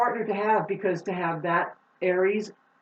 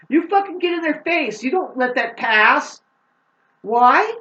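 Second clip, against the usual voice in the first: fired-up urging; 6 semitones higher, 6 dB louder than usual. Real, not acted. Urging viewers to confront people.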